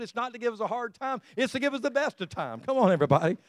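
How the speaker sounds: high, ringing preacher's climax; full-force preaching. Acted. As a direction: lift the congregation, pitch up.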